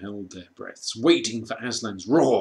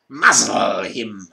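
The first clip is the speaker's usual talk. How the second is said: slow, louder cold order; witch's command; 10 dB louder, slower than usual. A narrator's reading. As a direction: command coldly. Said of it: "said the witch".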